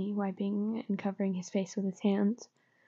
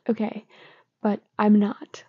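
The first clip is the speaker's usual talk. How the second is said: usual speaking voice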